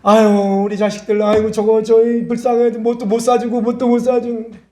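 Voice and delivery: high-pitched imitated parental wailing; mock tearful lament. Parent pities the children to the Lord, mock wailing. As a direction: whimper and wail on a high pitch.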